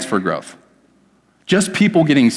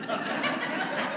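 breathy laugh; 15 dB quieter than usual. Real, not acted. Laughs at his own excuse.

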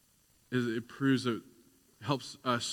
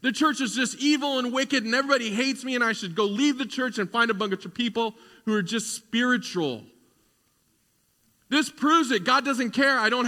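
higher and louder, indignant complaining; voice of doubt; 9 semitones higher, 9 dB louder than usual. Acted. Preacher voices his own doubt protesting to God, indignant and high. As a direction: play the whining inner doubter, worked up. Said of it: "My doubt would say right now".

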